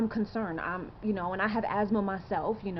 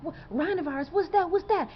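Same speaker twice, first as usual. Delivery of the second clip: retold surprise; 8 semitones higher than usual. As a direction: act out the shock of hearing a strange word. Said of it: "And we was like".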